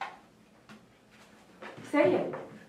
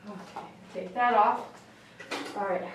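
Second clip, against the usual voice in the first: ordinary talk, nothing marked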